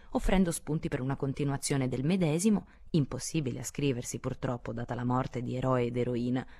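usual speaking voice

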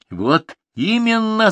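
high, slow outraged cry; shouting mother. Acted. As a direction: cry it out at the daughter. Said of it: "закричала мама".

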